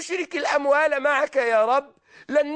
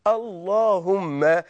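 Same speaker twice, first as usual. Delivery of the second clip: slow drawn out; 5 semitones lower, much slower than usual. Shown as a demonstration. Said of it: "أصل كلمة اللهم يعني يا الله".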